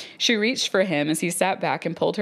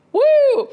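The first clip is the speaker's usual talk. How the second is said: loud high whoop; excited whoop; 11 dB louder than usual. Retold or imitated. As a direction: let out a wild cheer. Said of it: "Some of it is like".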